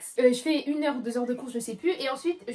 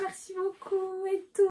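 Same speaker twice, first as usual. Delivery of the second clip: slow grateful thanks; 7 semitones higher, slower than usual. Retold or imitated. Touched thanks.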